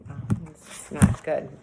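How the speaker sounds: plain reading voice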